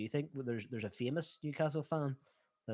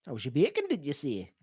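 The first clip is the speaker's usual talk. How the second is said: louder, put-on Geordie catchphrase; Geordie accent; 3 semitones higher, 8 dB louder than usual. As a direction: belt it out in Geordie. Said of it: "do a Geordie accent".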